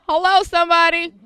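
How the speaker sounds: bright, loud hail to the room; excited call-out. Real, not acted. Host hailing the audience, excited and loud. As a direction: call out to the crowd like a preacher.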